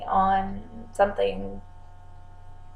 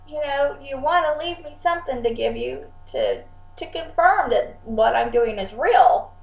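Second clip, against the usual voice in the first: polite higher voice; 6 semitones higher than usual. Retold or imitated. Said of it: "I'm like".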